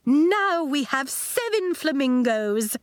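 high, drawn-out exclamation; exclaiming. A narrator's reading. Miz Fitznickel greets the new seventh flamingo, exclaiming. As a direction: announce it with slow, lifted delight. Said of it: "Miz Fitznickel exclaimed".

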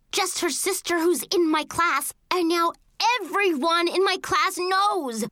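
high, aggrieved tirade; indignant yelling. A narrator's reading. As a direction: keep yelling your grievance, high-pitched. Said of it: "I yelled".

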